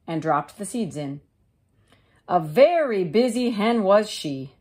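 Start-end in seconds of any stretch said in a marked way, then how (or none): none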